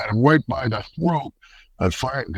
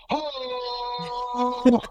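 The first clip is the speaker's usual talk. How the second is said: mock gasp; 12 semitones higher, 6 dB quieter than usual. Mock exclaim in story.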